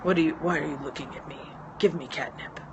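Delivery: a hint of a pensive, thoughtful cat; cat's voice. Voicing her cat demanding catnip, lightly pensive. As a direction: lightly voice the cat as a brooding spokesman. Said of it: "He kind of sounds like that... pensive, thoughtful, deep".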